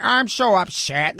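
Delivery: a whiny mock complaint; mock upset whiner. Host mocking oversensitive viewers, whiny mock pout. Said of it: "How do you wake up every day just like".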